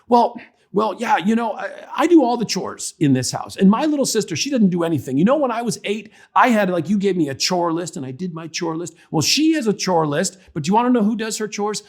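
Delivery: high-pitched, mock-indignant daughter; aggrieved preteen. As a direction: protest the unfairness like an upset older sister. Acted upset daughter pours out grievance.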